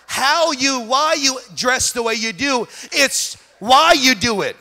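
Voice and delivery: loud, high preaching crescendo; full-force preaching. Acted. Pastor calling the congregation to revival, loud preaching. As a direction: drive it home to the church.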